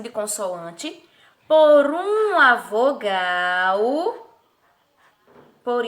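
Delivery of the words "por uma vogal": very slow, "vogal" stretched long and rising; drawn-out answer. Shown as a demonstration. Teacher explaining the syllable cui, "vogal" drawn out. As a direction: hold the end of "vogal".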